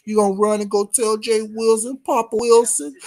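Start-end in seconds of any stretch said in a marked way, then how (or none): none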